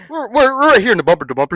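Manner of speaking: fast, loud mock news report; traffic reporter voice. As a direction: rattle it off like live radio traffic.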